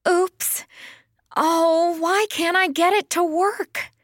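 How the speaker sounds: high-pitched, exasperated whine; foot-stamping childlike frustration; frustrated. A narrator's reading. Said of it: "with a stamp of her hoof".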